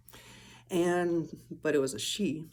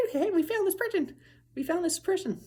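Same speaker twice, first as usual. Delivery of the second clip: much higher, squeaky excited voice; high cartoonish alien calling out; 14 semitones higher than usual. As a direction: tell the others with glee. Voicing the other light, excited.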